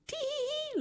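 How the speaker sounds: very high tittering tee-hee; little woman's squeaky-high storybook laugh; giggling. A narrator's reading. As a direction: giggle way up at the top of the voice. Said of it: "laughed the little woman".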